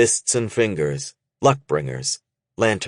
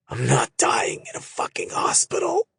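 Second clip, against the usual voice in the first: harsh whisper; 6 semitones higher, 3 dB quieter than usual. A narrator's reading. Wounded man refusing the hospital.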